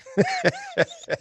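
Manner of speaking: sudden high burst of laughter. Real, not acted. Laughs at the "did it?" tease.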